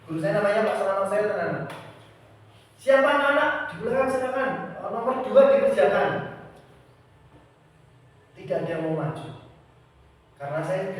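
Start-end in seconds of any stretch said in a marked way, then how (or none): 2.8-6.1 high, loud teacher calling on the class. Retold or imitated. Guru memanggil murid di kelas. (guru memanggil murid dengan suara tinggi dan keras)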